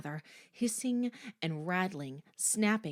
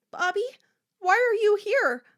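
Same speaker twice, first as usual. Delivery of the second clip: high, loud, urgent question; anguished, raised-pitch appeal; urgent dream plea; 10 dB louder than usual. A narrator's reading. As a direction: cry out to a lost sister. Corky calls dead sister in dream.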